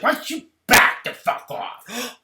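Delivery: loud, high, slow mock yell; hammed-up screaming at Denise; exaggerated screamed back the fuck off; mock furious shout. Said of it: "She's like".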